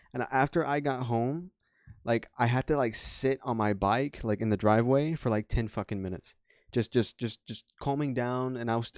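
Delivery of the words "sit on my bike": ordinary talk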